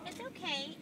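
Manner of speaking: ordinary delivery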